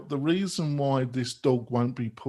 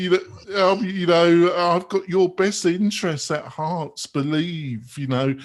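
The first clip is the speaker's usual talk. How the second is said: smug leader voice, louder and higher; mocking the leader; 4 semitones higher, 6 dB louder than usual. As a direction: puff up like a benevolent dictator, loud. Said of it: "he seems to be saying".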